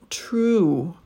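true drawn out, going up then down; isolated stretched true; stretched last word. Shown as a demonstration. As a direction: stretch true with a rise and fall. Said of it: "it's gonna go up and then down".

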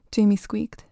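usual speaking voice

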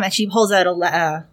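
usual speaking voice

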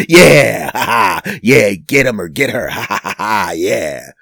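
loud cheering and mocking laughter; gloating cheer. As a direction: cheer on the pile-on loudly. Cheering at politician bashing.

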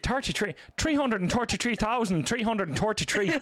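hammed-up "Thirty-three" with T for each TH; Irish TH as T. Shown as a demonstration. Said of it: "Not everybody says tree".

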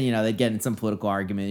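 ordinary delivery

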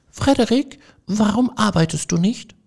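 small field mice calling up to Frederik; squeaky mouse voices. A narrator's reading. The mice ask idle Frederik, in high squeaky voices.

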